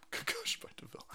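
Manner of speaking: breathy whisper, nearly silent. Real, not acted. Streamer whispering a little aside after laughing. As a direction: whisper it under your breath.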